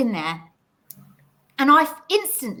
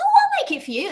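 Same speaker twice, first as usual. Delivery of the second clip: high friendly quote; 5 dB louder, faster than usual. Retold or imitated. Friend offering to make tea.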